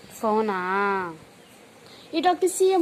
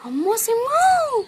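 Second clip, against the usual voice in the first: higher, very slow drawn-out wail; imitating sister's cry; 9 semitones higher, much slower than usual. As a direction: stretch it out like a little girl crying. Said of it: "చెల్లి ఎలా ఏడ్చిద్ది?". (ఎత్తైన, చాలా నెమ్మదిగా సాగదీసిన ఏడుపు అనుకరణ)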